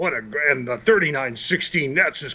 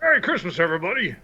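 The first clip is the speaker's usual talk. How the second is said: Santa greeting; faster than usual. Acted.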